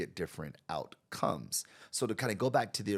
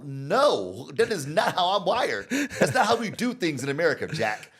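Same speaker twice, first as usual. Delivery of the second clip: much higher, louder laughing protest; laughing refusal; 9 semitones higher, 9 dB louder than usual. Retold or imitated. [name] refusing the client, loud high laughing protest. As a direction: refuse with amused indignation. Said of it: "And I go".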